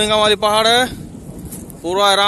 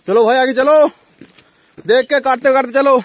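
raised, higher call to companions; 5 semitones higher than usual. Real, not acted. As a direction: urge the group on ahead. Calls companions onward while climbing.

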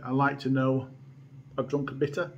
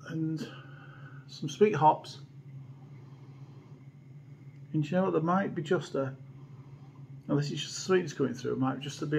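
glass held at mouth; speaking while sniffing beer; talking into a glass; 7 dB quieter than usual. Real, not acted.

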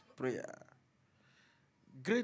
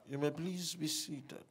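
normal talking voice

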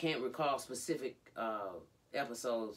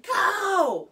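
very high, loud, drawn-out yell; hysterical scream; 14 dB louder than usual. Retold or imitated. Retelling Jade ordering her mom out, hysterical scream. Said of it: "Like, hysterically".

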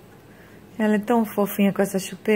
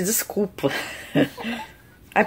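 usual speaking voice

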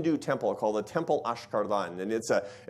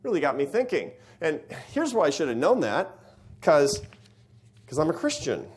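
ordinary delivery

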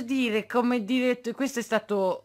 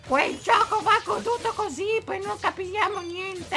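hammed-up whining of a critic begging for no cuts; mocking whiny critic; 11 semitones higher, 5 dB louder than usual. Parodying critics whining at the streamer, high mock wail. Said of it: "sennò la gente mi critica".

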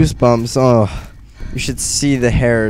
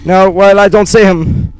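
frightened; 8 semitones higher, 7 dB louder than usual. Real, not acted. Frightened searching.

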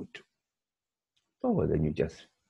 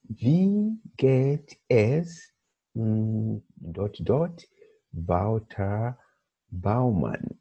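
drawn-out reading; 3 semitones higher, much slower than usual. Shown as a demonstration. Teacher reading the first exercise item slowly aloud to the class. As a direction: stretch each word out.

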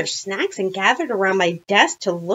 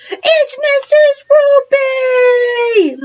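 shouting; 10 dB louder than usual. A narrator's reading. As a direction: shout the discovery to everyone. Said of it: "Michael shouted".

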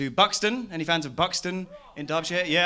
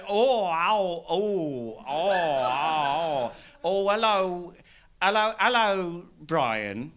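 confused bumbling local; 3 semitones higher, much slower than usual. Retold or imitated. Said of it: "having these conversations like".

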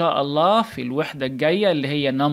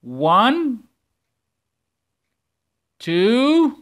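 each number called loud and high, slow gaps; teacher calling numbers for pupils to echo; loud counting; 4 semitones higher, 5 dB louder, much slower than usual. Shown as a demonstration. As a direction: lead the class count at full voice. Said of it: "عايز اسمع".

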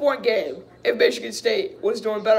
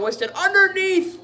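higher, rising excitement at the play; excited play call; 10 semitones higher, 4 dB louder than usual. Real, not acted. Fan commentating as Winston scores underneath, excited. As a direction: jump up high on the call.

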